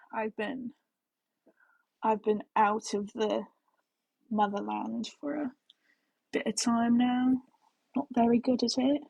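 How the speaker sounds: slow, careful British accent; rusty attempt at a British accent, slowed down. Shown as a demonstration. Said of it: "my accent was better".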